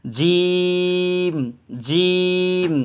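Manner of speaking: জিম held very long and high; drawn-out jim. Shown as a demonstration. Teacher to students, a long held জিম. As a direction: stretch জিম over three beats, very slowly. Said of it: "একটু ভালো করে আপনারা শুনবেন".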